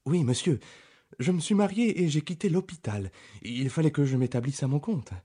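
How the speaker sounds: put-on the young country doctor answering Holmes; eager Dr Mortimer. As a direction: pitch him up, keen and earnest. Reader voices Dr Mortimer.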